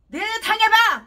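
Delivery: high, loud yelled retort; preacher shouts the victim's angry answer; outraged shout. Retold or imitated. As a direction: throw it back at them, shouting. Quoted furious retort.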